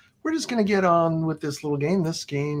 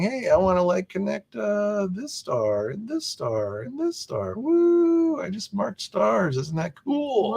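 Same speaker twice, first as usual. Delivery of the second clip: excited; 7 semitones higher, slower than usual. Retold or imitated. Voicing an excited player.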